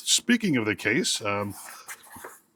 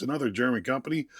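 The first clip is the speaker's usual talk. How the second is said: ordinary talk, nothing marked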